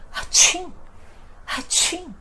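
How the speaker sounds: loud high acted sneeze, atchim; mock sneeze. Shown as a demonstration. Teacher miming a sneeze so learners hear espirrar, mock sneeze. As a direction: sneeze it out for the class. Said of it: "as pessoas espirram".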